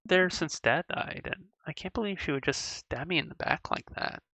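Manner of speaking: soft, hurt voice, slowing down; barely holding it together over a best friend; hurt and quiet. Acted. Daughter telling dad about best friend's betrayal, quietly hurt.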